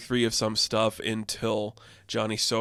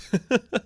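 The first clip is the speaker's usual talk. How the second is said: short high laugh at the joke; real laugh; 12 semitones higher, 3 dB louder than usual. Real, not acted. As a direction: burst out laughing, rising high. Laughs at old Kal-El.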